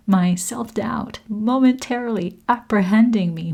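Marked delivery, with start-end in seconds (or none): none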